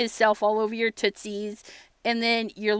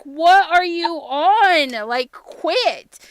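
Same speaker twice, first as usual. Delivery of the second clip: annoyed; 7 semitones higher, 8 dB louder, much slower than usual. Retold or imitated. Woken by the cat, annoyed.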